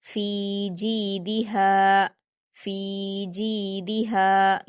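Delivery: फी जीदिहा chanted slowly, long फी, जी and हा, short दि; stretched madd. Shown as a demonstration. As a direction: hold every long vowel of फी जीदिहा but दि. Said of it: "दो हरकत खींचकर पढ़ेंगे".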